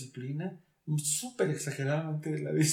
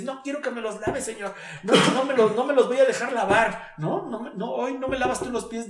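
higher, insistent mock protest; acted indignant refusal; 7 semitones higher, 6 dB louder than usual. Peter refusing to let Jesus wash his feet, indignant protest. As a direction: play Peter's stubborn refusal, pitched up.

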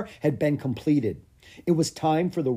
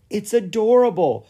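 higher, louder delight; delighted coo; 10 semitones higher, 7 dB louder than usual. A narrator's reading. Queen seeing the baby dragon, high delighted gush. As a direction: gush over something cute. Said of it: "said the queen".

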